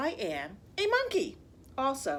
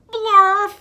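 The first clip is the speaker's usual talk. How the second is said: loud silly word; 9 semitones higher, 18 dB louder than usual. Acted. Absurd word forced to read.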